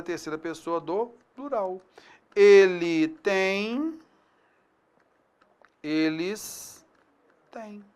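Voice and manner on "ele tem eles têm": very slow, word by word, same sound for "tem" and "têm"; spelled-out teacher demo, dwelling on each word; slow demonstration. Shown as a demonstration. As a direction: draw out "Ele tem" and "eles têm" so the ear hears no difference. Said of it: "sem implicações acústicas".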